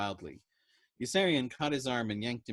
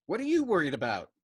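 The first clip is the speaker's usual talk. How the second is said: higher, louder injured question; offended innocence; 7 semitones higher, 7 dB louder than usual. A narrator's reading. Dobbs asking Yossarian, offended and louder. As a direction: act wounded and innocent. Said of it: "asked with offended innocence".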